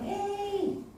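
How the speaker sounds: high, drawn-out taunting "hey"; teasing call, pitched well up; mocking jeer. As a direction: jeer at them from afar, acted. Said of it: "놀리기도 했을 거예요".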